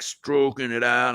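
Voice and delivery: ordinary talk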